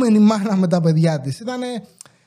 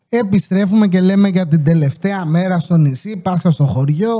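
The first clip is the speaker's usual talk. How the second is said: ordinary delivery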